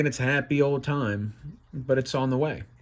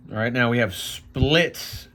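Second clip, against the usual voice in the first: usual speaking voice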